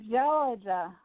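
slow, drawling Southern belle Georgia; Southern belle accent. Shown as a demonstration. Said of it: "I love that Southern Belle accent".

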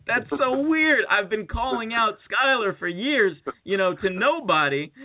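high-pitched retold astonishment; amazed. Drunk man amazed by the real Skyler, high excited voice.

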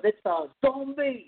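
high, drawn-out mock scream; mock alarmed cry. Reviewer acting out Zed falling on the grave, mock alarm. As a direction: stretch it out high like a B-movie victim. Acts out greaser's startled cry.

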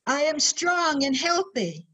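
slow, proud, high declaration; booming motivational speech. Shown as a demonstration. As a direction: declare it slowly with pride. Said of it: "repeat after me".

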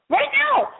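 high, insistent "Right now"; urgent right now. Pretend. Host urges listeners to call immediately, high and insistent. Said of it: "You have to call me right now".

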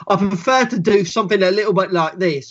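rough, un-posh British dialect; perverted British accent. Shown as a demonstration. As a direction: slide into a skewed British character accent. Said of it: "sort of perverted versions of them".

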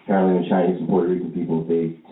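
ordinary talk, nothing marked

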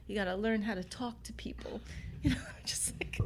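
whispered, half-laughing aside; whispered lesson. Retold or imitated. Retelling dad's lesson on the way home, mock whisper. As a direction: hush it like a private scolding.

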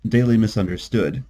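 ordinary delivery, nothing marked

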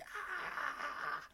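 thin, high, quiet "aah"; very soft high-pitched mock cry as the demon pours in; imitated demon possession noise. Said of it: "it's like".

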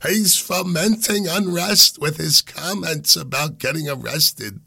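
put-on high-pitched old Italian lady accent. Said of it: "that was my old Italian lady accent".

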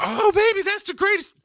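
high, loud, mock gushing parent; gushing praise. Dad refusing to fawn over a child's drawing, mock gushing. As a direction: overdo the praise in a squeal.

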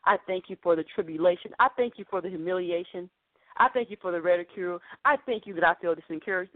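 low, even-toned pretend thanksgiving; mock dutiful prayer. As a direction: recite thanks by rote, pitched low. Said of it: "he didn't say".

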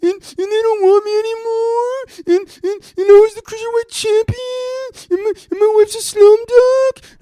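very high, hammed-up crybaby wail; mock sobbing in a squeaky, stammering falsetto; Drake Maverick. Ranting fan mocks a fired wrestler crying on Twitter, fake bawling. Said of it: "This dude is literally crying".

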